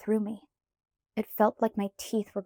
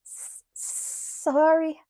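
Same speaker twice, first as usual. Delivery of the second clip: slow, high chattering stutter; shivering stutter; 8 semitones higher, 4 dB louder, much slower than usual. A narrator's reading. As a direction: stammer it through the cold. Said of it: "I stuttered".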